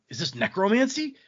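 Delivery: high, alarmed question; startled question. Retold or imitated. He sees the girl he shot stand up, startled. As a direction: blurt it out in shock.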